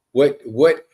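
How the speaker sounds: usual speaking voice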